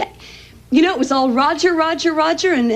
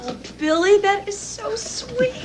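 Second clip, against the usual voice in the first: tender; 8 semitones higher, 5 dB quieter than usual. Acted. Touched by Billy's gift.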